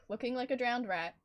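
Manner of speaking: usual speaking voice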